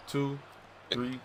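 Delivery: ordinary delivery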